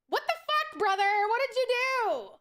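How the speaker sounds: mock outrage. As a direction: stretch it high like a shocked parent.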